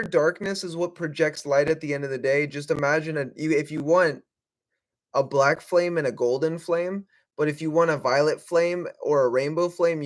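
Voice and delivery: ordinary delivery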